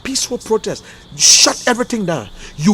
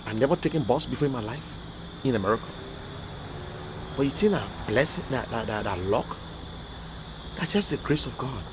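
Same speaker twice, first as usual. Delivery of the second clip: emotional hush; 8 semitones lower, 12 dB quieter than usual. Real, not acted. Emotional reflection on blessing.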